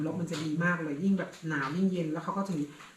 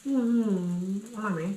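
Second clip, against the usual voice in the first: coaxing; much slower than usual. Real, not acted. Coaxing child to eat.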